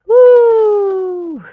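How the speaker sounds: high, loud whooping exhale; loud woo. Real, not acted. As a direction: let out a big relieved woo. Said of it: "exclaims after the bit".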